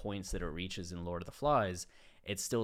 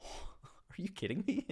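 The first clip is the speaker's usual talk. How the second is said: high-pitched exasperated disbelief; sigh, then can't believe what you just heard; 10 semitones higher, 3 dB quieter than usual. Real, not acted. Speaker reacting to the kid burning himself, incredulous and high.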